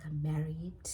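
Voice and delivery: low, voiceless admission; hushed guilty reply; whispered confession. Retold or imitated. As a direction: whisper it sheepishly. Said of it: "He's like".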